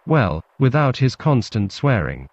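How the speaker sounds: plain reading voice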